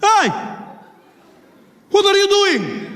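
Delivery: high, accented mock challenge; indignant passenger. Passenger opposite confronting the man, indignant accented shout. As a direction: call him out in a put-on Middle Eastern accent.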